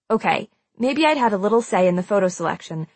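ordinary delivery, nothing marked

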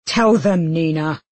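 mildly forceful demand. A narrator's reading. Matthias before the watching group, demanding. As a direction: press her to confess. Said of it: "he demanded".

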